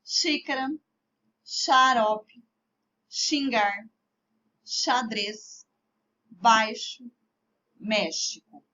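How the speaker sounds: "Xícara, xarope, xingar, xadrez, baixo, México" with X as SH. Shown as a demonstration. Teacher lists examples for pupils, "Xícara, xarope, xingar, xadrez, baixo, México" with X as SH. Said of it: "X com som de C H".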